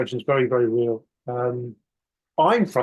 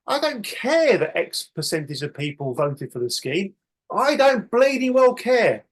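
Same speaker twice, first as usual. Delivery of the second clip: livid rant; 3 semitones higher than usual. Retold or imitated. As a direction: tell the regulator off like a furious investor. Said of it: "I'd be livid".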